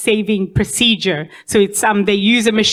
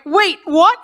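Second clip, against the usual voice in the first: startled; 7 semitones higher than usual. Retold or imitated. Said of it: "And I was like".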